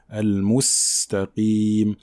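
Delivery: slow "Al-mustaqim" with a long hissing S; whistled Sin. Shown as a demonstration. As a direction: show the S clearly in "Al-mustaqim", held and sibilant. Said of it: "en appliquant le sifflement".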